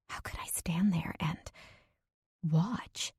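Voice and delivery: soft whispered self-reproach; ashamed whisper. Acted. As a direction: whisper the question to yourself, ashamed. Ashamed inner question.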